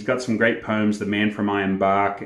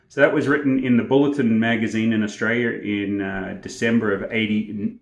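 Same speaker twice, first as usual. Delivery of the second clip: plain reading voice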